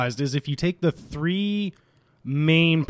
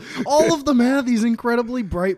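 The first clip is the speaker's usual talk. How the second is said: high, loud, marvelling exaggeration; excited gushing; 10 semitones higher, 6 dB louder than usual. Real, not acted. Insisting all of them have blue eyes, excited gush. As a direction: push it up high and loud in amazement.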